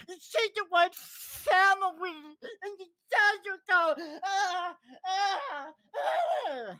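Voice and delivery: high, mock-tearful whine about family; mocking whiny criminal. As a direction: ham up a fake sob as the criminal. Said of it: "says the criminal".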